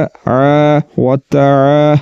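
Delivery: आ व ता slow and drawn out; slow recitation. Shown as a demonstration. Teacher reciting the lengthened ayn. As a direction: stretch the alif of आ. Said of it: "ये पढ़ा जाएगा".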